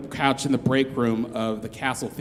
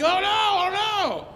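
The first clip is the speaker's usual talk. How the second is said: high, drawn-out scream of terror, far higher; terrified scream; 4 dB louder, slower than usual. Retold or imitated. A stranger screaming in terror somewhere in the park, far higher. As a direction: scream it in panic, stretching each word. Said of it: "like, scream, like, a yell".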